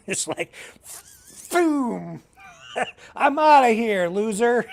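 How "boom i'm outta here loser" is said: loud hammed-up exit line; playful loud retort; mock storm-off. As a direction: slam the door on him, loudly. Quoting her storming off.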